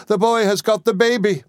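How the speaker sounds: very high, horrified outcry; alarmed cry. A narrator's reading. Miss Murdstone horrified at boy holding baby, crying out. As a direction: raise the alarm at the top of your range. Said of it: "cried Miss Murdstone".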